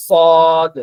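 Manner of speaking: সদ with a thick, heavy opening; thick sad. Shown as a demonstration. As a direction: make সদ fat and strong. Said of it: "সদটা একটু মোটা করে".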